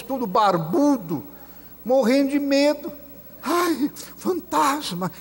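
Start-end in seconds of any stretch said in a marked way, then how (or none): 3.4-5.1 high startled squeal of fright; hammed-up fear of a ghost; mock scared cry. Apóstolos com medo. (imitando susto, agudo)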